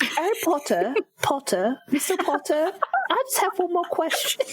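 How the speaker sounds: high, hammed-up British; mock a British accent, pitched well up; silly fake-British voice; bad British accent. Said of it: "my really terrible British accent".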